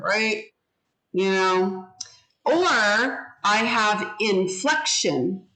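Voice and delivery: ordinary talk, nothing marked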